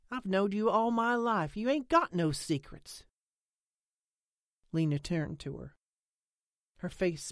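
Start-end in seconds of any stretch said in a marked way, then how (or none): 0.1-3.1 higher-pitched, mildly insistent; mild challenge. A narrator's reading. Friend dismissing Lena's talk of secrets, mildly indignant. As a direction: push back, a touch indignant.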